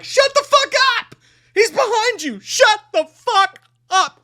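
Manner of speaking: very high, strained yell at the screen; exasperated scream. Retold or imitated. Viewer screaming at noisy movie characters, desperate exasperation.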